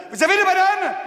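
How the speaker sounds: high chirpy little monkey; squeaky monkey. Acted. Little monkey asking the barman, high and squeaky.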